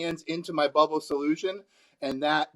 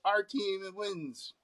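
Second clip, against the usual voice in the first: slow, soft, higher sports chant; mock supporter; 4 semitones higher, 9 dB quieter, much slower than usual. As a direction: stretch out a half-hearted victory cheer. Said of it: "the athletic supporter that goes".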